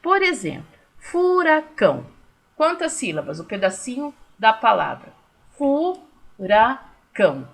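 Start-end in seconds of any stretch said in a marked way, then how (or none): 1.0-2.1 FURACÃO slow and high; slow example word. Shown as a demonstration. Teacher gives pupils the example word furacão, slowly. 5.6-7.5 FURACÃO very slowly in three separate beats, fu, ra, cão; syllable by syllable. Shown as a demonstration. Teacher showing pupils the pieces of furacão, syllable by syllable. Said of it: "Três vezes eu abro minha boca".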